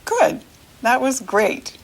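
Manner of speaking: normal talking voice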